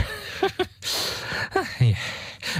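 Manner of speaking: hohes, tonloses Dämonenlachen; gehauchtes Kichern. Acted. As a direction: lach hoch und leise gehaucht. Dämon lacht über Ahnungslosen. (tonloses hämisches Lachen)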